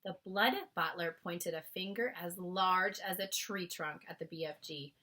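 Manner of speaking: usual speaking voice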